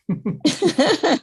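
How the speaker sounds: high, loud burst of laughter; amused burst of real laughter. Real, not acted. Teacher teasing student about her reading, amused laugh.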